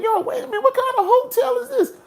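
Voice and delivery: high, rising alarm at the hotel checkpoint; nervous. Retold or imitated. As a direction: squeak it out nervously. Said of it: "I'm getting nervous now".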